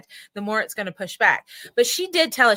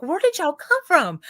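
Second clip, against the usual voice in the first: high, incredulous retold question; mock surprise; 6 semitones higher, 4 dB louder than usual. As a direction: act stunned at the newcomers. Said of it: "And I'm like".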